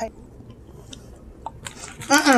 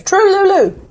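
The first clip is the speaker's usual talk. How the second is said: "True Lulu" repeated higher, incredulous; amused disbelief; 10 semitones higher than usual. Real, not acted. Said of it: "I kid you not".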